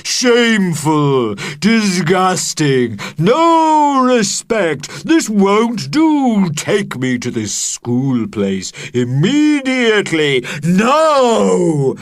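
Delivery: enraged dragon bellowing; outraged dragon. Acted. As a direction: thunder out the indignation. Dragon's furious outburst.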